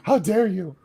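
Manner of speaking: gasping, slow mock indignation; hammed-up affronted gasp; mock offense. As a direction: gasp and act scandalized, drawn out slowly.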